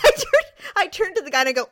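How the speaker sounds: ordinary delivery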